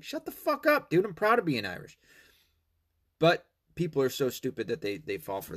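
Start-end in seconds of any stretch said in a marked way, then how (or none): 0.0-2.0 high-pitched acted indignation; indignant retort. Proud Irishman to an objector, indignant high retort. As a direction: fire back in mock outrage, pitch jumping up.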